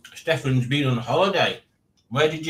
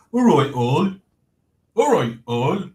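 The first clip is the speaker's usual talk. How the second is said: a lightly put-on viewer's greeting; lightly voiced cheery catchphrase; Stickman TV impression; 3 semitones higher, 4 dB louder than usual. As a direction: mimic a mate's catchphrase, a bit louder. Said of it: "That's how he talks".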